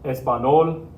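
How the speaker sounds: "Español" misread with a plain n, espa-NOL; español without tilde. Shown as a demonstration. Teacher showing students the wrong word, plain-n mispronunciation. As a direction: drop the ny glide from "Español", tossed up as a test.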